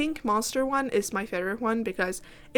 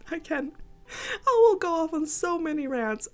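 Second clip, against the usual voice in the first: laughing; 7 semitones higher than usual. Real, not acted. Laughing through words.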